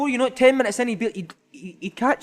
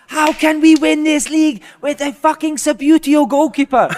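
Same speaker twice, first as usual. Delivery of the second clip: loud, slow, higher furious outburst; sudden angry rant; 8 semitones higher, 10 dB louder, slower than usual. Retold or imitated. Manager ranting at the video clips, loud and slow. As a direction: flip instantly into a furious telly rant. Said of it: "He would just switch quickly".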